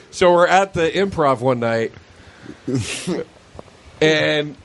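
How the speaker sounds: ordinary talk, nothing marked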